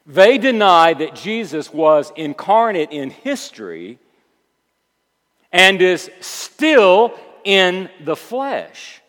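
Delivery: loud proclamation, slowing heavily to the end; weighty, emphatic pulpit climax; forceful preaching. Acted. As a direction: drive it home word by word.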